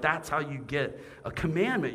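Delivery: ordinary talk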